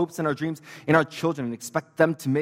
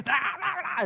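mock gibberish. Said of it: "He's like".

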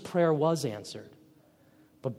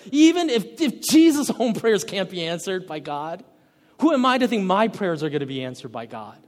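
indignant doubter; 4 semitones higher, 6 dB louder than usual. Retold or imitated. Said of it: "and say".

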